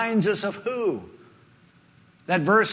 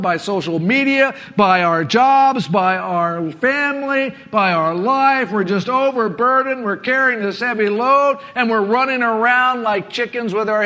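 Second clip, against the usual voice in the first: loud, driving sermon voice; preaching at full force; 4 semitones higher, 6 dB louder than usual. Acted. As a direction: push it out like a pulpit climax.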